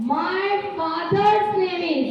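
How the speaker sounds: very slow, word by word; slow model sentence. Shown as a demonstration. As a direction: drag each word out for children to copy. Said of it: "S apostrophe S लगाना होता है". (बहुत धीरे, एक-एक शब्द)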